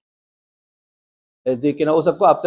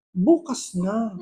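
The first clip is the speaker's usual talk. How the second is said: slow, soft demon voice; demon's stalling reply; 8 dB quieter, much slower than usual. Retold or imitated. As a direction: drag it out softly as the demon. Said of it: "Sabi sa akin". (mabagal at mahinang boses ng demonyo)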